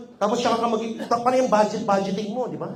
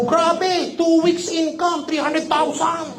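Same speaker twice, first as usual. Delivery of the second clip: higher, slow showing off big income; mock boasting; 6 semitones higher, much slower than usual. Seller showing off his cheque to followers, slow mock boast. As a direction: gush over the cheque like a show-off.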